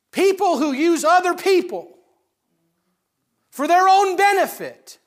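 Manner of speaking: rising fervent sermon cry; preaching at full force. Acted. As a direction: drive it home from the pulpit. Preaching climax, rising intensity.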